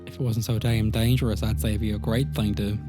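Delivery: ordinary talk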